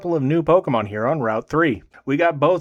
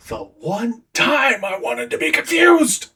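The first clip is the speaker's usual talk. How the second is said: exasperated; 9 semitones higher, slower than usual. Real, not acted. Editor exasperated at missing footage.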